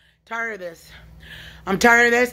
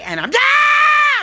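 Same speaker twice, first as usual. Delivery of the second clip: sudden scream; 13 semitones higher, slower than usual. Real, not acted. Jolted by something inside, sudden scream. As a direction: let out a sharp shriek. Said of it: "This yelling and screaming".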